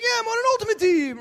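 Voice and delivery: high, loud eager dude voice; eager guy. Retold or imitated. Guy at business meeting brags about ultimate team, high and loud. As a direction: squeak it out like a proud frat guy.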